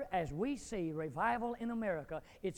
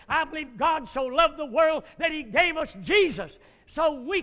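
loud, fervent revival preaching; fiery preaching; 7 semitones higher, 12 dB louder than usual. Acted. Preacher urging the congregation toward revival, fiery preaching.

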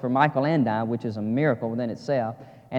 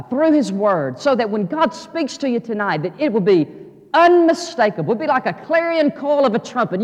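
higher, louder preaching that climbs to a peak on unmistakable; full-force preaching; 6 semitones higher, 6 dB louder than usual. Acted. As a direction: preach it out to the whole church.

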